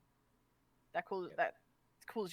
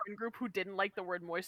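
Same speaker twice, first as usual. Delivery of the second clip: ordinary delivery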